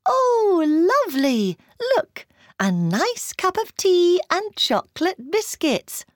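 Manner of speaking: high, delighted classmates' exclamation; exclaiming. A narrator's reading. Classmates admiring an enormous cup of tea, exclaiming. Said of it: "all exclaiming".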